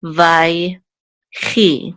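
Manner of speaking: "vai, chi" split by a pause; syllable by syllable. Shown as a demonstration. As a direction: sound out "vai", then "chi", separately. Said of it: "We'll say chi".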